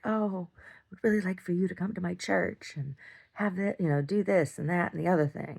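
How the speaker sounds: sugary high voice, a little far off; mock sweet invitation. Retelling Candace's letter, lightly mocking sweet voice. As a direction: lightly mimic her syrupy church pitch.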